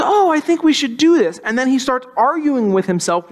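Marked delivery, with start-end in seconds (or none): 0.0-1.4 lightly voiced timid Smeagol, a touch higher; a hint of meek, polite Smeagol. Preacher voicing timid Smeagol for the kids, lightly raised. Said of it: "Smeagol's this timid, well-mannered somewhat character".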